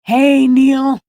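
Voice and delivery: high, loud greeting called across the room; calling out. Real, not acted. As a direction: hail him from a distance.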